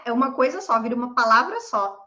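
ordinary delivery

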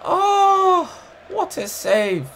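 high, slow drawn-out gasp of awe; amazed at save. Real, not acted. Gamer watching the keeper stop a shot, slow amazed cry. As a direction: let the disbelief stretch out.

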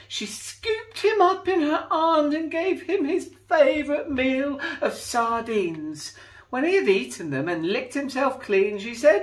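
put-on lively read-aloud for children; storybook reading. A narrator's reading. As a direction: tell it like a bedtime picture book. Reading a picture book aloud.

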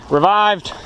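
high, loud announcement; shouting revived. Real, not acted. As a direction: call it out triumphantly.

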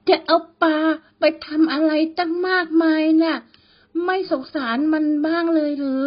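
high, cold, eerie woman's voice; a ghost taunting from the dark, chilling and high; shrill cold ghost woman. A narrator's reading. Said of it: "เสียงแหลมๆ แต่เยือกเย็นของหญิงสาว".

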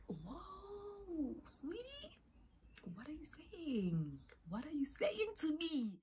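high squeaky disbelief, breaking into a whisper; mock-shocked high voice. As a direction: gasp it out in stunned disbelief. Shocked reply to a confession.

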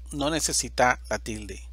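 usual speaking voice

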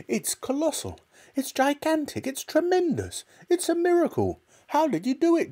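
very high, rising excited voice; excited shouting father. Acted. Mister Cranky dancing round the hen, building to very high delight. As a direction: build up in delight with every word. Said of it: "Mister Cranky shouted".

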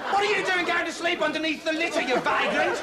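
high, outraged telling-off; scolding park keeper. Acted. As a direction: berate a vagrant, voice pitched right up.

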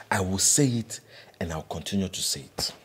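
ordinary delivery

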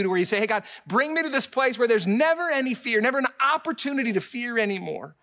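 high and loud, stirring the congregation; preaching at full force. Acted. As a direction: lift the whole room with each line. Preacher builds to a peak.

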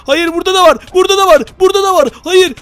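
scared gamer seeing another monster; alarmed shouting. Real, not acted. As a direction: shout no in fright.

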